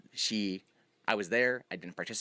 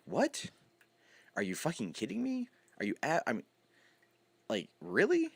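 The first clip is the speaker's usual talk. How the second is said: high, disbelieving outrage; incredulous; 10 semitones higher than usual. Retold or imitated. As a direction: ask it in shocked disbelief. Recalls being thrown out.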